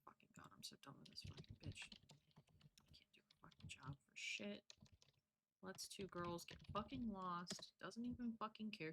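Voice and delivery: very soft, half-whispered griping; muttered grumbling. Real, not acted. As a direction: mutter it under your breath, fed up. Grumbling under breath at game character.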